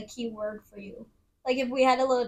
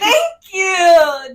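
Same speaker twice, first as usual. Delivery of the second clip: excited thanks; 6 semitones higher, 11 dB louder than usual. Real, not acted. Accepting alpha praise.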